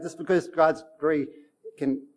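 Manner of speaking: ordinary talk, nothing marked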